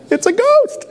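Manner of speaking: very high, hammed-up shriek of "It's a ghost!" through laughter; mock terrified cry. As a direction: squeal "It's a ghost!" like a panicked disciple, laughing. Acting disciples' frightened cry.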